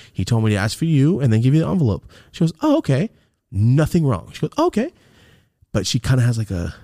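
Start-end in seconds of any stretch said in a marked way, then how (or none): 2.6-3.1 high, sing-out polite acknowledgement; high-pitched, breezy receptionist imitation; chirpy woman's reply. Receptionist's polite reply voiced in story. 4.6-4.9 high, sing-out polite acknowledgement; high-pitched, breezy receptionist imitation; chirpy woman's reply. Receptionist's polite reply voiced in story.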